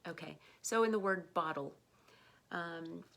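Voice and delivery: ordinary talk, nothing marked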